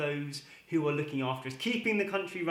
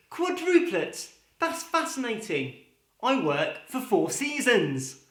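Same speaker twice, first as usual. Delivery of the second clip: high, slow amazed squeak then deadpan; surprised father; 7 semitones higher, slower than usual. Acted. Third father hears of quadruplets, slow and amazed. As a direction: stretch out the shock, then land it.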